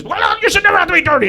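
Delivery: put-on high, loud, shaking old-lady rant; hammed-up elderly outrage; old woman losing it. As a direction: imitate a flustered elderly woman at full tilt. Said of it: "So she's losing her mind. She's shaking".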